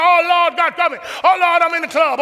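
high, loud panicked churchgoer; anxious stammering. Acted. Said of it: "you're going".

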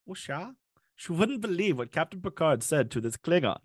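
put-on a shaky German accent, gossiping about the book. Killer updating victim on Star Trek plot, weak German accent. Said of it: "I can't keep a German accent going".

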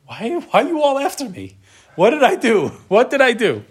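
high, pleading, exasperated protest; a wronged younger brother crying what did I do; David protesting. Retold or imitated. David pleads his innocence to his angry older brother, high and exasperated. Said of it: "That line we're gonna see many times with David".

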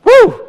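very high, loud woo; whooping like a wrestling fan. Acted. Wrestling crowd cheer.

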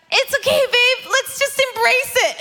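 high, coaxing mommy voice; imitated soothing mom. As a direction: coax an upset little one, pitched well up. Mother soothing her upset small child.

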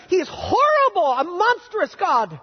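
sarcastic, theatrical dismay at a kind God; mock horror. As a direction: pretend to be scandalized by a generous God. Sarcastic mock complaint about God.

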